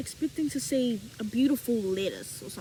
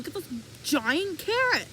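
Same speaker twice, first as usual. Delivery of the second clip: ordinary talk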